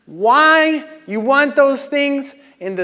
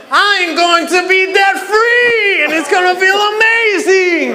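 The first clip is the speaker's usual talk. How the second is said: excited shout; 6 semitones higher, 4 dB louder than usual. Shown as a demonstration. Coach showing the crowd how to yell their goal. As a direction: shout it like it's awesome. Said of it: "You gotta say it like it's awesome".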